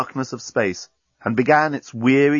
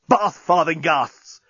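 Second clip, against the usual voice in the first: exclaiming; 6 semitones higher, 3 dB louder, slower than usual. A narrator's reading. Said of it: "exclaimed Bolfas".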